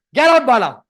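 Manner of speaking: loud get out, dismissing the candidate; a loud mock boss ordering him out; mock angry order. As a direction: throw him out with a shout. HR tearing up the resume.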